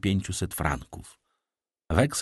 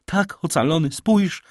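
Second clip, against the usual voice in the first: put-on high, urgent girl's cry; excited calling; 9 semitones higher, 5 dB louder than usual. A narrator's reading. Daughter urges her father to look, excited cry. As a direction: call out joyfully, climbing higher. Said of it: "wołała dziewczyna".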